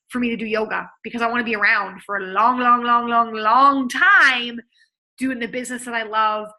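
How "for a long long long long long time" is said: each long stretched and louder; drawn-out long. Real, not acted. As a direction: lean on every long. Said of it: "stretching long for emphasis".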